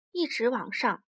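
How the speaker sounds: ordinary delivery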